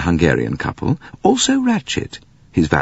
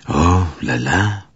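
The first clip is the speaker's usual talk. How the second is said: vexed; much slower than usual. Acted. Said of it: "said Poirot vexedly".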